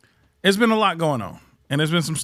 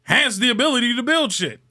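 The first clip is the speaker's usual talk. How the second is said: heated rant; 9 semitones higher, 3 dB louder than usual. Real, not acted.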